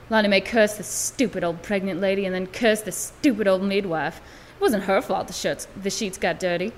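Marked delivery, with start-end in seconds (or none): none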